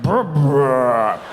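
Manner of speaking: loud, rolling mock belch; imitated burp. Body finally burping after fair warning, loud imitated belch. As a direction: burp it out with rolled lips. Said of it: "my body was like".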